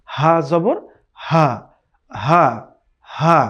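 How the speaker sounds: হা said slowly and firmly from the throat; strong Arabic ح; hard ha. Shown as a demonstration. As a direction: press hard on হা. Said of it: "এটা হচ্ছে শক্ত করে".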